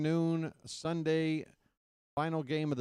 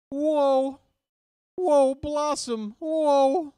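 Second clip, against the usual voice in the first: very high, loud sitcom whoa; Joey Lawrence whoa; 13 semitones higher, 9 dB louder, much slower than usual. Shown as a demonstration. Streamer answers the super chat with a loud, very high Joey Lawrence whoa. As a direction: squeal it out like a nineties sitcom catchphrase. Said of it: "Joey Lawrence from the show Blossom".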